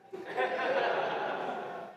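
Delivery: a soft breathy laugh at himself. Real, not acted. Preacher laughing at his own fib, breathy laugh.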